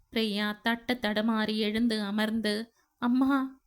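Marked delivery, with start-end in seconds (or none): 3.0-3.6 first call to mother, mild sob; starting to sob. A narrator's reading. Daughter calls her dead mother, beginning to sob. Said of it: "என்று விசும்ப ஆரம்பித்தாள்".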